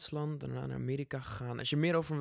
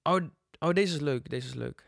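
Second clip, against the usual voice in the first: usual speaking voice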